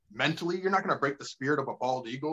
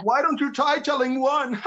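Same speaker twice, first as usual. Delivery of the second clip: mock heckler; 10 semitones higher, 6 dB louder than usual. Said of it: "She's like".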